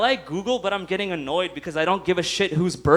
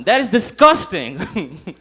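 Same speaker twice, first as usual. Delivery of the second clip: laughing disgust; 7 dB louder, much slower than usual. Real, not acted. Comedian disgusted remark.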